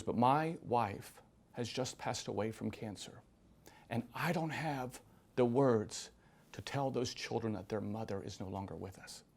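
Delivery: slow, soft and low; halting, subdued confession of loss; quiet grief. Retold or imitated. Widowed father explaining about his children's mother, quiet grief.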